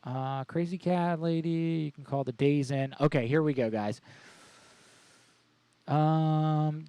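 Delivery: ordinary talk, nothing marked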